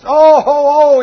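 repeated loud, high pained "oh"; hammed-up howling in pain; mock cry of pain. Retelling pain as man rebukes devil, mock howls. Retold pain getting worse.

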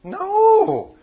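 loud, high, drawn-out no; emphatic no. Real, not acted. Preacher answers his own question to the congregation, emphatic no. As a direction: cry out an emphatic, incredulous no.

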